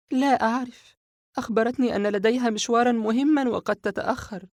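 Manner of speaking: put-on a shaken girl blurting it out, high-pitched; frightened young girl. Sara voiced by narrator. (صوت فتاة خائفة بنبرة عالية)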